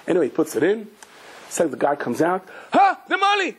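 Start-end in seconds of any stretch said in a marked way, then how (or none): 2.7-3.6 much higher gasp of horror; hammed-up shriek of shock; shocked cry. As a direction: cry out high at the empty shelf. Friend discovers the loss.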